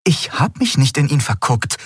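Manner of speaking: defensive denial; insistent. A narrator's reading. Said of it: "beharrte Endriel".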